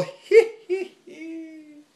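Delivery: high giggling hee hee. Acted. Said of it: "He began to giggle".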